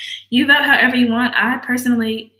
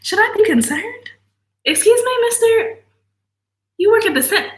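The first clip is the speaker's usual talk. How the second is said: high alarmed imitating herself; high-pitched pretend panic; shocked eavesdropping; 11 semitones higher than usual. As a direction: squeak out mock alarm at the news. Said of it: "He said".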